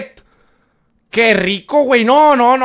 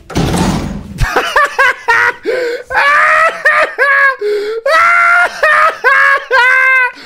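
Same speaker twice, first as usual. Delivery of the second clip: high shrieking laughter; screaming laugh. Real, not acted. Reacts to fall.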